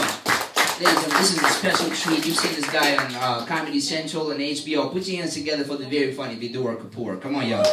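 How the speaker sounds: loud MC introduction; big, loud stage intro; show announcer. Real, not acted. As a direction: work the crowd up for the next act. Introduces the next comedian on stage.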